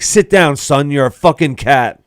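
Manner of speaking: loud, high, commanding shout; mock police bark; imitated stern cop. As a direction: shut the kid down as a cop. Voices cop silencing drunk Tim.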